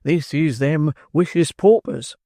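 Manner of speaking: pompous beadle. Acted. Bumble voiced by narrator.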